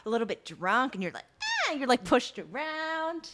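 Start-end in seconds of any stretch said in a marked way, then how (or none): 1.4-1.7 sharply high breathy ah; high mock gasp. Friend acting out an elevator kiss, high gasp. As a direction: gasp like being swept off your feet.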